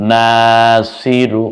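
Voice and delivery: Shahida stretched syllable by syllable, each vowel held long; slow drawn-out reading. Shown as a demonstration. Teacher to learners, showing the joined word, very slow. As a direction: draw out Shahida slowly and clearly. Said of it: "এবার হরকত দেন".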